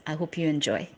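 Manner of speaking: plain reading voice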